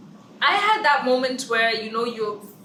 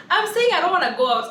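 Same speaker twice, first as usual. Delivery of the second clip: raised, fast, exasperated; fast higher protest at the pushy friend; annoyed friend protesting, raised and quick; 6 semitones higher, 6 dB louder, faster than usual. Retold or imitated. Retelling pushback to pushy friend.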